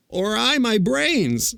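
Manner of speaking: high, drawn-out tearful wail. A narrator's reading. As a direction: wail it slowly, high-pitched. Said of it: "wailed the Scarecrow".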